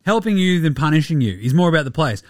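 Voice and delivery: low, fast, lifeless recital; mock-earnest low monotone, rattled off; hostage video statement. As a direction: imitate someone reading a forced statement. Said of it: "horrible fucking hostage video that he released".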